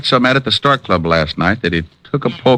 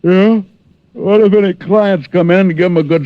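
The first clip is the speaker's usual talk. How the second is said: startled; 9 semitones higher than usual. Acted. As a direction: jump up at the news. Detective startled, police in office.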